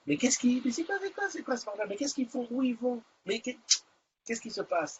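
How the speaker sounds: small, high, soft baffled voice; baffled bystander. Pretend. Host plays a passer-by puzzled by the singing, high and hushed. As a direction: stammer questions softly in a high voice.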